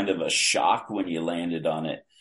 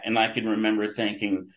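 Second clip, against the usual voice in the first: usual speaking voice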